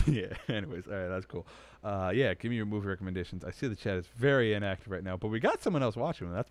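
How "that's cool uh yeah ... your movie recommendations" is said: ordinary delivery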